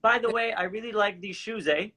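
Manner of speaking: chatty Canadian aside ending on a tag "eh?". Retold or imitated. Shopper to shoe clerk, letting the Canadian eh slip. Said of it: "you sound Canadian, plus you said A".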